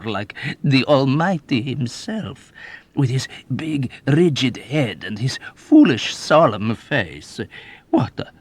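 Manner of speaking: put-on high, loud, gleeful old man's boasting; old rogue bragging to a young officer; sly old man. A narrator's reading. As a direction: relish every wicked memory. Old man relishing his prank.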